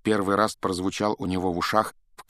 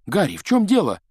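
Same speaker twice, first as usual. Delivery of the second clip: puffing, high and loud; out of breath; 10 semitones higher, 7 dB louder than usual. Acted. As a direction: call out while panting. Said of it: "отдуваясь".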